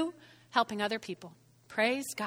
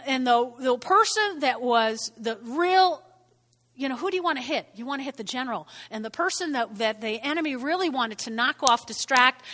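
normal talking voice

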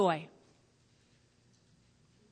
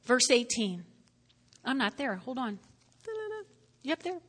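normal talking voice

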